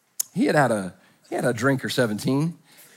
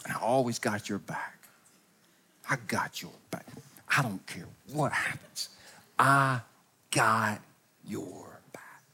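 breathy, much softer loyal vow; drunk whisper; 5 semitones lower, 9 dB quieter than usual. Retold or imitated. Drunk friend whispers a loyalty pledge, much softer, whispering. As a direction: whisper the promise close in. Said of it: "followed up with this".

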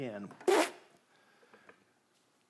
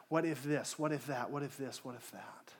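fading worry; 4 semitones lower, 9 dB quieter than usual. Acted. Listing fears, dying away.

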